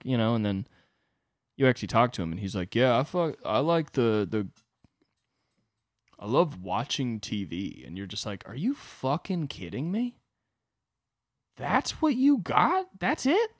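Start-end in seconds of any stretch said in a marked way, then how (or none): 2.8-7.8 lightly voiced dim guy, slow on the last line; a hint of a dull dude. Dull hot guy voiced in the story. 8.5-13.6 disbelief climbing much higher, slow; rising into stunned disbelief; incredulous. Retold or imitated. Disbelief at the dull guy.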